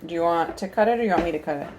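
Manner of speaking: ordinary talk, nothing marked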